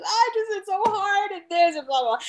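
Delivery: high, mock-complaining kid; whiny student voice. Teacher mimics her reluctant student complaining, high mock whine. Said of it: "He's like".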